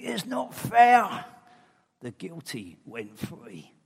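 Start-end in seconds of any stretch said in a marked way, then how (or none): 0.0-1.2 high, loud cry of protest; outraged cry. Pretend. A believer crying out at injustice, loud protest. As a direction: shout it out like it's unbearable.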